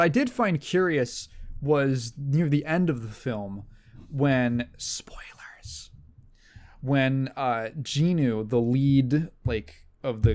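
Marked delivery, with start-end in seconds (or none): 4.8-5.9 a quick unvoiced whisper of warning; whispered spoiler warning. Real, not acted. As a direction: drop to a secret whisper for the spoiler.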